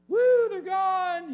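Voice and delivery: high, loud, slow woo; gleeful woo. Retold or imitated. Preacher mimicking the pigs' plunge, high, loud and stretched. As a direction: sing out the plunge.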